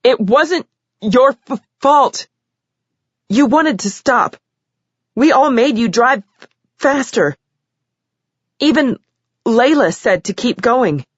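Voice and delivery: weep through the confession. Acted. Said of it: "She was weeping now".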